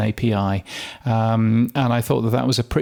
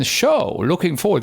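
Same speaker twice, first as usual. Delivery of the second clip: light imitated Dutch accent; a hint of a Dutch host; 5 semitones higher than usual. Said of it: "This is my, uh, Dutch accent".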